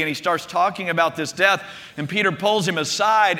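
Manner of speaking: ordinary delivery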